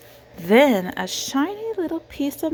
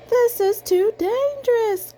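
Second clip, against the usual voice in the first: much higher worried sheep voice; nervous sheep; 3 dB louder than usual. A narrator's reading. Reading aloud, Sheep worrying on the tractor in a high voice. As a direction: fret like a timid sheep, up high. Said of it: "what she thought was".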